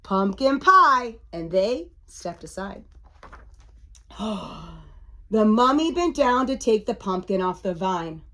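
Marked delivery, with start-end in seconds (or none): 4.2-4.7 soft storyteller's gasp; little intake of breath for effect; mock gasp. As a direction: gasp in pretend suspense. Dramatic gasp for young listeners.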